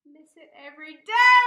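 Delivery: very loud cry of feeling; emotional outburst. Real, not acted. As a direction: wail the line out. Fan reading the tweet's last line.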